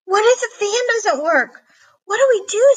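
put-on high, frantic worried boy; panicked. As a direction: panic rising as the ghost nears. Bernard panics about the ghost.

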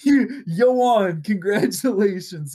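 laughing mock farewell; giggling through a high mock send-off.

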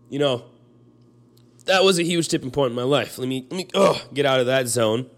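pushing through the emotion to move on; emotional. Real, not acted. Telling his grandpa's death was a turning point, still shaken. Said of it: "as you can tell by my voice".

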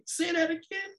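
high-pitched, incredulous question; retold disbelief. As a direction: ask it as if you can't believe your ears. Retelling his disbelief at her offer.

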